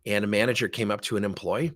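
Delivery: ordinary talk, nothing marked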